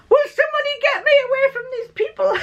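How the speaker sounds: laughing hammed-up high shout for help; desperate plea. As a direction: play the trapped woman's cry while laughing at the memory. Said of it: "she's saying".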